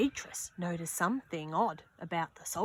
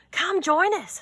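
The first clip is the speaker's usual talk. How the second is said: high, louder call to join; inviting friend; 10 semitones higher, 7 dB louder than usual. Acted. Millie calls Beatrice to skate, high and loud. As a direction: call out from the ice. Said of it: "said Millie".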